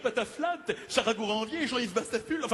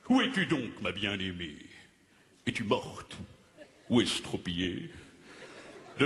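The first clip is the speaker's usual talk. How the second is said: declaimed poem; 6 semitones lower than usual. Acted. Comic playwright declaiming his own poem to the audience. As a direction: recite the verse like a grand tragedian. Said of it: "que je vais déclamer devant vous".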